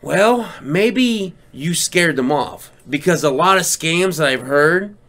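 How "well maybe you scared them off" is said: slow musing. Retold or imitated. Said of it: "my second thought was".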